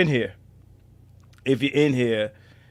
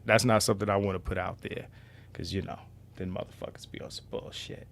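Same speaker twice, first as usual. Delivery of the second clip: whisper; 8 semitones lower, 15 dB quieter than usual. Real, not acted. Wary of trolls in chat.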